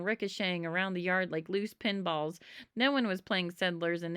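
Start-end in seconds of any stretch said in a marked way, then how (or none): none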